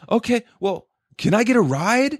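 high-pitched mock pleading; high mocking request; homeless man. Acts out homeless man asking for ride.

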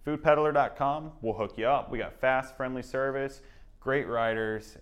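upbeat radio ad read; announcer voice. Acted. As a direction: sell it like a radio spot. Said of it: "you got that, that announcer voice".

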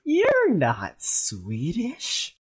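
put-on very slow Swedish accent; mock Swedish accent. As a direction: drag it out like a Swede. Teasing mock Swedish reply.

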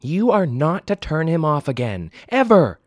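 loud, rising on "ever"; stern order. Acted. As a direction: lay down the law to your son. Mother forbids angrily.

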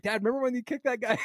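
put-on high, quick child voice; kids teasing Dad. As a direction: imitate an eager kid bringing up an old story. His kids teasing him, retold.

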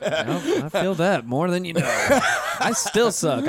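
slow, high laughing admission. Real, not acted. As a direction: laugh it out at yourself. Laughing at own skating fails.

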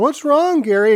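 high cooing question; cooing to a cat. Retold or imitated. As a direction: coo it to the tiger. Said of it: "He's like".

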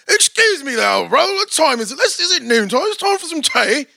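high-pitched British caricature; mock British accent. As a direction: put on a hammed-up British accent. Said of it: "Man, with the British accent".

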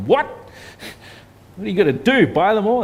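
playfully aghast at buying everything; a hint of the wife's shocked laugh; incredulous wife. Wife's quoted reaction.

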